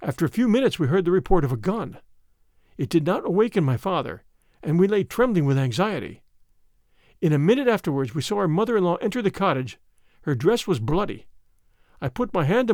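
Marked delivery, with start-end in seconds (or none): none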